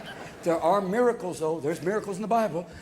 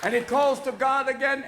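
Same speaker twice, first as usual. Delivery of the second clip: usual speaking voice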